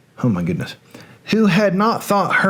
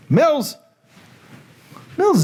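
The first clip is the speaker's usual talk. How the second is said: excited greeting; 10 semitones higher than usual. Real, not acted. Greeting a viewer on the live stream.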